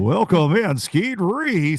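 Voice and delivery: a hint of a club announcer calling a name in; Don Day impression. Guest imitates the announcer who welcomes anglers, mild announcer boom. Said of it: "I sound like, uh, who is the fella, Don Day".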